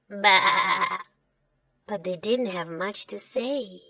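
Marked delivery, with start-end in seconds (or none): 0.1-1.0 imitated sheep's baa; a single wavering baa; sheep bleat. Sheep answers Sam.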